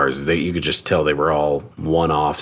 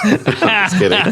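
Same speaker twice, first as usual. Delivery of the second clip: laughing; 13 semitones higher, slower than usual. Real, not acted. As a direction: giggle through just kidding.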